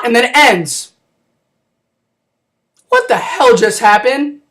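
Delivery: ordinary talk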